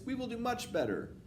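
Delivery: high-pitched obedient reply; meek witch audience. A narrator's reading. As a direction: promise it meekly in a high voice. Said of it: "murmured the audience".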